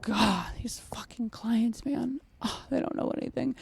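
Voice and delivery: whispered, muttered contempt; mock whispered grumble. Trainer mocking a dog trainer complaining at home, whispered. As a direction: grumble it under your breath. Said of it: "dog trainer goes home and is like".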